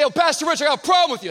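loud, high, quick challenge; tough kid confronting. Retold or imitated. Tough young guy challenging his pastor, loud and high. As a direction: get in his face with it.

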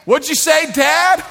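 very high, loud mock holler; teasing family member. Kids to a dad who lost his voice, teasing shout. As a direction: gleefully taunt as if you can't hear.

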